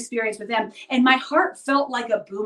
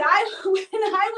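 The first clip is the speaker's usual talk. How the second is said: with a light laugh, higher; a chuckle breaking into the restart; 9 semitones higher, slower than usual. Real, not acted. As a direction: laugh through the words. Laughs while restarting sentence.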